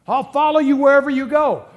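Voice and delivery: loud, high, hammed-up eagerness; overly enthusiastic. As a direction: overplay the eager follower, nearly shouting. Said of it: "He's enthusiastic".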